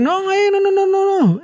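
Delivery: high urgent string of no; urgent protest. Retold or imitated. Friend retelling how he stopped the wrong burrito order, high and urgent.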